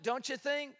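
ordinary delivery

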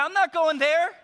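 high, loud mock refusal with a laugh; hammed-up stubborn prophet saying no; mock Jonah refusing. Jonah refusing God's call, high loud mock refusal. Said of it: "He's like".

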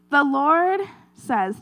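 normal talking voice

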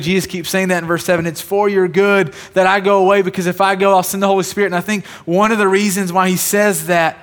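emphatic sermon climax; full-force preaching. Acted. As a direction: drive it home to the congregation.

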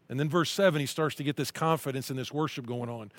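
usual speaking voice

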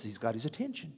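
ordinary talk

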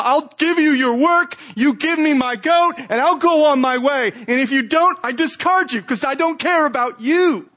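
lightly hammed-up transactional voice; mock selfish bargainer. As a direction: voice the greedy heart bargaining with God. Voicing idolatrous heart to God.

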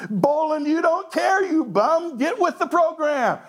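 high, mock-scolding tirade; mock scolding wife. Wife scolding her husband, high mock scolding. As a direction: nag the husband, hammed-up. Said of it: "What she's really saying is".

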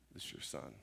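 soft breathy whisper, barely voiced; God's whisper. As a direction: hush it like a secret. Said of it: "whispered to me and said".

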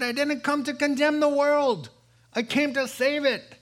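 loud, high, fervent sermon climax; preaching at full force. Acted. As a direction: pound the point home from the pulpit.

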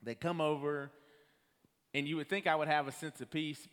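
ordinary talk, nothing marked

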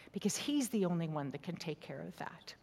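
whispered devotion. Real, not acted. Quiet sermon moment, whispered.

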